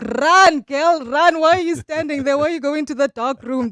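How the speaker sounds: yelling at horror movie. Pretend. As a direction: scream warnings at a horror heroine. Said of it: "you're like".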